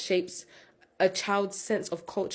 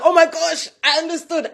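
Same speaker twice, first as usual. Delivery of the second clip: high, loud, thrilled retelling of a gasp of joy; squealing with delight, much higher and louder; excited; 9 semitones higher, 10 dB louder than usual. Retold or imitated. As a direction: relive the rush of finally understanding. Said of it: "I was so excited".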